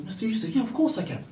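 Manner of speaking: high-pitched, obliging yes; eager mock assurance. Retelling his answer to Mister Yusuf, eager assurance. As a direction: reassure him readily, pitch climbing high. Retold confident reply to the dad.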